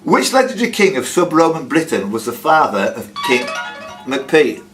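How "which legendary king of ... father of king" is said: ordinary talk, nothing marked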